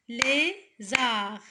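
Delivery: "Lézard" split as LÉ, pause, ZARD; syllable by syllable. Shown as a demonstration. Teacher counting syllables for pupils, "Lézard" chopped in two. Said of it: "deux syllabes".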